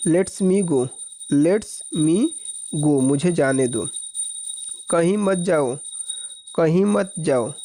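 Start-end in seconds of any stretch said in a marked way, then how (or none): none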